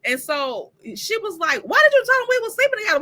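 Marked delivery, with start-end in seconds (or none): 1.6-3.0 fast. Retold or imitated. Voicing Haley's angry question.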